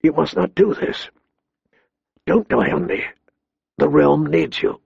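soft, grief-choked pleading; thick with grief. A narrator's reading. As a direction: plead softly through grief. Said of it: "in a voice thick with grief".

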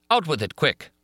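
high, urgent demand; fierce exclamation. A narrator's reading. Raoul hurrying Christine to tell it, fierce exclamation. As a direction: press fiercely for an answer. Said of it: "exclaimed Raoul fiercely".

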